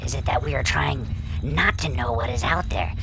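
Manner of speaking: usual speaking voice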